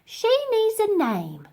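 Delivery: chirpy maid voice; Dotty. Acted.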